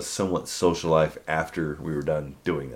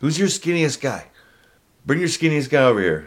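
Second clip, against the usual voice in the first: drill instructor; 6 semitones higher than usual. Retold or imitated. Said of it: "drill instructors".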